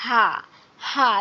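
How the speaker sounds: হা released with a loose, open throat; open-throat pronunciation. Shown as a demonstration. Teacher demonstrates the small ha, open-throat হা. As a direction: let the throat go on হা. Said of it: "উচ্চারণটা হচ্ছে গলা ছেড়ে দিয়ে".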